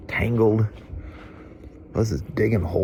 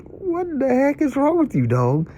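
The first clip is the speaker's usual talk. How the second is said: much higher exasperated scolding; scolding the dog. Retold or imitated. Retelling finding Sasha stuck, high-pitched scolding the dog.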